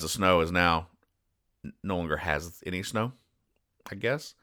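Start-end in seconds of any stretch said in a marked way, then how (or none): none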